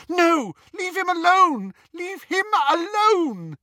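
very high, loud pleading scream; desperate scream. A narrator's reading. Hermione to captors hitting Ron, screaming. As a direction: scream for them to stop. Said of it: "and Hermione screamed".

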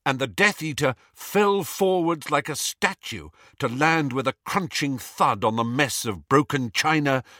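usual speaking voice

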